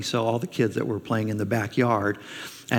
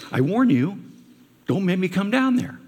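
warning parent; 6 semitones higher, 3 dB louder than usual. Retold or imitated. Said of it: "hasn't God said this a few times".

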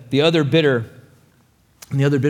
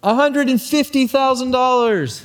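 much higher, called across the room; rival's bid; 10 semitones higher than usual. Acted. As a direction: crush him with the number. Rival bids across auction crowd.

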